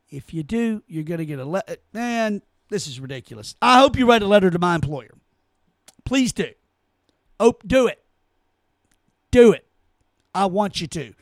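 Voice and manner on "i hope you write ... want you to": louder and louder mocking dare, ending loud; sarcastic dare. Real, not acted. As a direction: go on, do it, taunting them. Daring critics to write his employer.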